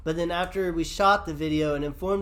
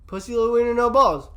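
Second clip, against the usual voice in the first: loud, higher-pitched punchline delivery; emphatic catchphrase; 7 semitones higher, 7 dB louder than usual. Retold or imitated. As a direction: land it like a triumphant reveal. Re-enacts coining the catchphrase dare.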